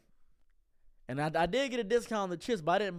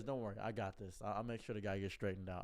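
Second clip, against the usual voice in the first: calm reassurance; 9 semitones lower, 11 dB quieter than usual. Retold or imitated. Shop owner voiced quietly.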